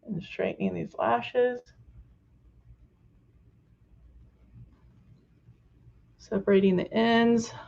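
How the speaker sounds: talking with mascara face on; applying mascara. Real, not acted. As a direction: mouth stretched for mascara. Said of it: "I'm making my mascara face".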